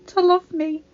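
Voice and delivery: tearful. A narrator's reading. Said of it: "eyes filled with tears".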